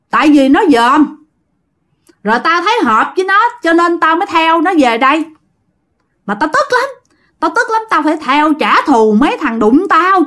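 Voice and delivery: high, agitated vengeful spirit voice; high-pitched ghost ranting about his death; angry ghost. Acted. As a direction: rant like a wronged dead man. Ghost answers the master.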